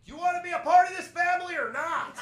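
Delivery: hammed-up girlfriend ultimatum; mock girlfriend. Girlfriend pressuring him to coach, high mock demanding voice.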